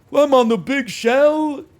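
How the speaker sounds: very high, loud, slow put-on voice; mocking streamer impression. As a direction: belt it out as an exaggerated imitation. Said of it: "Here's my impression of you playing".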